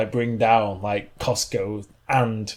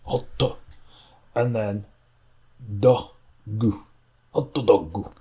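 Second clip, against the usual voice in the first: Japanese reading; slower than usual. Shown as a demonstration. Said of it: "you just got to say it in the accent".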